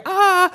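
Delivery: high, loud "ah" of alarm; mock panicked scream. Preacher voices us going downhill, mock scream. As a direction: cry out in pretend panic. Acts people panicking as things collapse.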